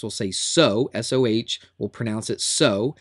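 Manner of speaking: SOH said as so, one syllable; say it as a word. Shown as a demonstration. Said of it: "we'll say it like this".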